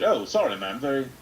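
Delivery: very British, apologetic goblin; over-polite British accent, pitch jumping about. Acted. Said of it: "this accent on this goblin".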